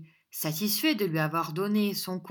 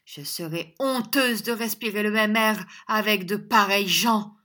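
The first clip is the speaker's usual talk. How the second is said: raised, loud disdainful declaration; scornful, higher-pitched young lady; haughty contempt; 4 semitones higher, 7 dB louder than usual. A narrator's reading. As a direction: declaim your disgust with the company. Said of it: "des yeux pleins de mépris et de hauteur".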